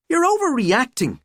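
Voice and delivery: high-pitched, half-hearted scolding; trying to scowl. A narrator's reading. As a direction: protest with an attempted scowl. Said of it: "trying her very best to scowl".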